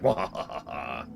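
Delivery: staged "wah ha ha" villain laugh; villain's evil laugh. Retold or imitated. Great Evil One returns, mock evil laugh. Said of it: "comes back and is like".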